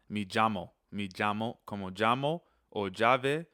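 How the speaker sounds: "llamo" and "llave" begun with an English J sound; double L as J. Shown as a demonstration. Teacher demonstrating the double L, pronounced as J. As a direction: say "llamo" like "jamo", "llave" like "jave". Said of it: "double L sound is a J sound".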